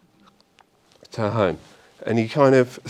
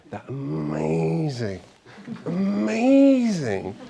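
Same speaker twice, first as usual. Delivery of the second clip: "amazing" stretched very long, swooping higher the second time; drawn-out relish; 5 semitones higher, much slower than usual. Retold or imitated. Host offers friends the rosé from France, with "amazing" hugely drawn out. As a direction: savour "amazing", dragging out the middle vowel each time. Recalling the holiday wine with relish.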